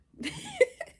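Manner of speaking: a loud high burst of laughter; real amused laugh. Real, not acted. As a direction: break into a giggle. Laugh between lines.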